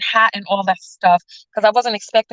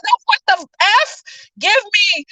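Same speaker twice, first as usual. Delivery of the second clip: high-pitched retold outburst; mock outrage; 11 semitones higher than usual. Customer rejects fat-ratio excuse, mock outraged. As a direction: object in a high, exasperated voice.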